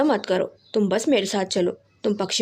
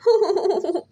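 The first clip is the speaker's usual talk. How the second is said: laughing; 8 semitones higher than usual. A narrator's reading. Wife laughs after praising.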